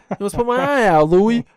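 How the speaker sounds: high, loud mock Jersey Shore guy; Jersey Shore accent. Imitating a Jersey Shore cast member scolding his buddy, in a loud Jersey accent. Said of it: "let me see if I remember any Jersey Shore".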